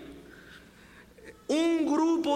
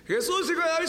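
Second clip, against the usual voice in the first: high, insistent cry; grito más fuerte; 14 semitones higher, 3 dB louder, faster than usual. Acted. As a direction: call out high over the hushing. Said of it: "empezó a gritar más fuerte". (grito agudo e insistente)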